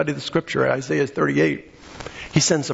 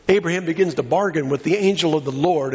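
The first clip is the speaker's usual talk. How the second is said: ordinary talk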